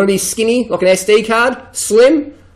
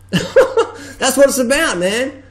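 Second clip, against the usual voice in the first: laughing; 5 semitones higher, much slower than usual. Real, not acted. Laughs at his own rant.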